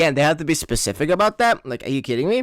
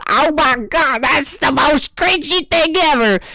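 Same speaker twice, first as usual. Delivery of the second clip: mock critic; 9 semitones higher than usual. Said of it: "watch my videos and say".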